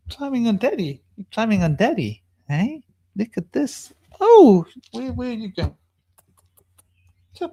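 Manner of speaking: sweet pet talk to a bird on his shoulder; cooing to a pet. Real, not acted. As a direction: coo at the pet climbing on you.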